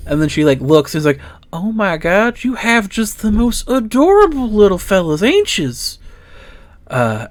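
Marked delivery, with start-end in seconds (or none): 1.5-6.0 high, doting coo over cute critters; gushing delight. Acted. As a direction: melt over the adorable little fellas. NPC gushes over the pets.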